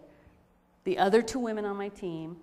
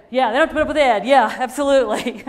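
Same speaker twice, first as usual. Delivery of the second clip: much louder, higher, emphatic agreement; big, loud "absolutely" tipping into a laugh; 4 semitones higher, 12 dB louder than usual. Real, not acted. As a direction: call it out loudly, breaking into laughter. Blurts agreement about boss, laughing.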